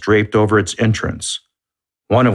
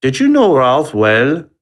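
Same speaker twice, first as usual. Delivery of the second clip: put-on higher questioning in a heavy French accent; a French detective asking in thick French accent; 6 semitones higher, 3 dB louder than usual. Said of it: "asked in a heavy French accent".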